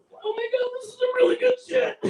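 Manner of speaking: high-pitched, giggly rave about the weed; stoned delight. Retold or imitated. Mom at the comedy club mimics a stoner raving about good weed, high and giggly. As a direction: gush like a thrilled stoner. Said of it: "And then there was".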